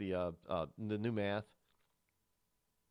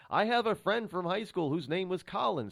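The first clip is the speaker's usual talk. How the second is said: ordinary delivery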